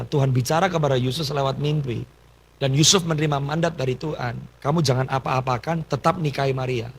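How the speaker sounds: usual speaking voice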